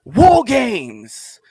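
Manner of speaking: loud, high ring-announcer call; announcer hype. Acted. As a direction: belt it out like a wrestling announcer. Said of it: "let's get into".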